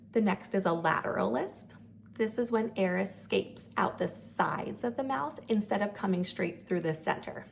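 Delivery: normal talking voice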